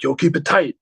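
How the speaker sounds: a hint of a hyped-up drummer psyching the band; imitating the drummer. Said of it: "He's like".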